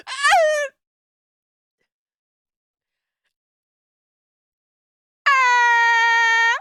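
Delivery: long high loud scream; shrill scream. Real, not acted. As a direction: scream as if attacked. Screaming at dog.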